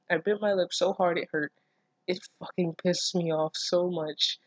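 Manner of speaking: ordinary delivery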